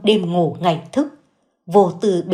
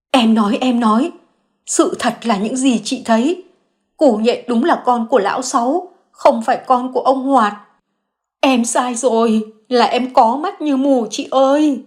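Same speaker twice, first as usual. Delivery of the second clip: distraught confession; 5 semitones higher than usual. Acted. Xuyến confesses to Nương that the boy is lão Sáu's, tearful. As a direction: confess everything in tears.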